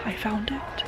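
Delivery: hushed, quick whisper. Real, not acted. Vlogger whispering near shoppers in the next aisle, quick whisper. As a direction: whisper so the people nearby won't hear. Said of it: "And it's very awkward".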